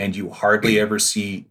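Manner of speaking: ordinary delivery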